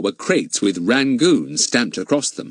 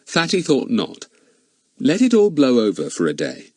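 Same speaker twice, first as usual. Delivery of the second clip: usual speaking voice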